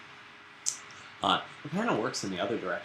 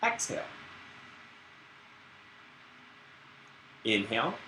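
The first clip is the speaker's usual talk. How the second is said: guided breathing; 2 semitones higher, 6 dB quieter than usual. Acted. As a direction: lead the class through each breath.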